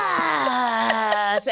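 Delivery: short hammed-up kid yell; silly kid outburst. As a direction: yell it like a goofy little boy. Kid's silly outburst retold.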